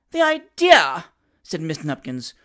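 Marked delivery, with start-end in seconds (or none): 0.1-1.0 much higher, louder cry of indignation; a forced, hammed-up wail; mock tearful outrage. A narrator's reading. Daughter complaining to her parents, hammed-up tearful outrage. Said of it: "forcing a tear".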